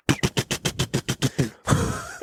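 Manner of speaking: rapid mouth-made AK burst; quick unvoiced rat-a-tat noise; imitated gunfire. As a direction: rattle off automatic gunfire with the mouth. Imitates AK fire at the ram.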